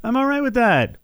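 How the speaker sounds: sing-song high shrug; breezy high retort. Retold or imitated. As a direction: toss it off high and carefree. Mocking reply to Trump critics.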